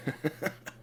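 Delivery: quiet acted chuckle that builds; chuckling. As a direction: start chuckling softly in character. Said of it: "starts chuckling".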